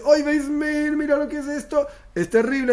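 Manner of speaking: anguished lament. Acted. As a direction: cry out in dismay at the punishments. Sinner sees punishments, laments. (lamento angustiado, mucho más agudo)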